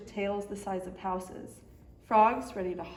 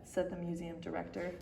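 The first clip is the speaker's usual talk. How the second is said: ordinary delivery, nothing marked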